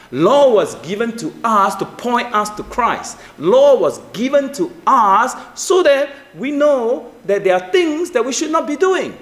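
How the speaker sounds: high voice with drawn-out emphatic words; impassioned preaching. Real, not acted. Preacher explaining to congregation why law was given, impassioned preaching.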